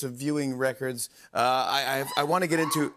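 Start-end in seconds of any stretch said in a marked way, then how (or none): none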